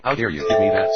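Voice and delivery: loud, indignant outburst; angry. Acted. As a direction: snap it out in outrage. Lorax snatches the treat, indignant.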